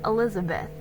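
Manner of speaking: ordinary delivery, nothing marked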